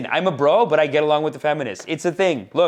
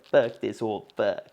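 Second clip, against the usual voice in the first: rock and roll friend; 6 semitones lower, 4 dB quieter, slower than usual. Retold or imitated. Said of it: "rock and roll friends that were like".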